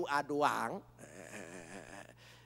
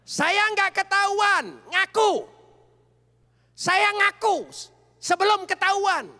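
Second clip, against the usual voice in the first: much higher and louder, slow and hammered; preaching shout; 13 dB louder than usual. Real, not acted. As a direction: shout it out to the congregation.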